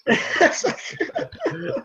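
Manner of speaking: high-pitched laughing. Real, not acted. Laughing after joke.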